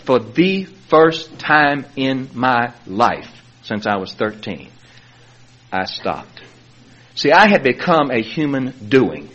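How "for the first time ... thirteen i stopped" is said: slow and soft; slow, soft, sincere. Real, not acted. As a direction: let it land slowly. Emotional climax, slowing down.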